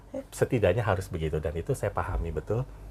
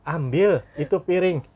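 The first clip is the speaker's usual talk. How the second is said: high, loud, slow mock command; voiced parent's order; 10 semitones higher, 7 dB louder, slower than usual. Parent telling today's child to fetch a plate, mock command. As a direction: order a child about, lightly acted.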